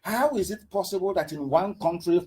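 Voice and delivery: ordinary talk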